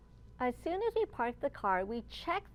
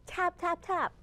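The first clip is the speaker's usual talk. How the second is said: "tap, tap, tap" higher and voiced; bright woodpecker knocks; crisp voiced taps; 5 semitones higher than usual. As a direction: pop each "tap" out clearly. Woodpecker tapping sound imitated.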